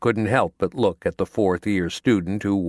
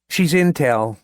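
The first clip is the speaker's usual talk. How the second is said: higher, louder buddy voice; friend's voice; 6 semitones higher, 4 dB louder than usual. A narrator's reading. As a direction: deliver it like a pleased reveal. Neil reports his findings.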